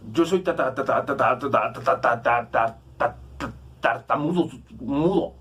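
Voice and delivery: mock stutter on "tartamudo", a long rapid run of "ta"; mock stammer. Preacher acting out Moses objecting to God, exaggerated stutter. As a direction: stammer out "ta, ta, ta" before finally landing "tartamudo, mudo". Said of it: "Moisés le dice ... tartamudo".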